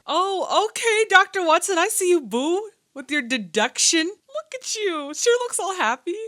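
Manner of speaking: high, loud playful teasing; teasing sing-song. Pretend. Teasing Watson in a mock voice.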